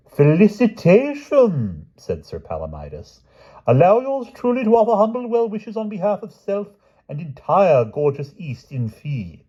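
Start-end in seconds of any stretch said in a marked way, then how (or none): 0.1-1.9 high, slow formal foreign knight; Palomides. Acted. 3.7-9.4 bestow it grandly; Palomides. Acted.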